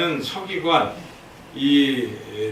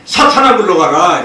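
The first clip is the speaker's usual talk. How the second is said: high, loud rebuke to drive Satan off; commanding shout; 3 semitones higher, 12 dB louder than usual. Acted. Jesus rebuking Peter possessed by Satan, a loud commanding shout. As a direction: bellow the command like casting out a demon.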